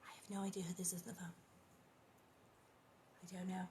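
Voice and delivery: fast whispered aside. Real, not acted. Reader whispers to herself about the phone, fast. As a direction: whisper it to yourself.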